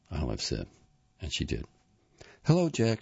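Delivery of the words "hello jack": high, louder-pitched hello; pitched well up for a greeting; bright phone greeting. A narrator's reading. Olive phoning Jack to come over, high-pitched greeting.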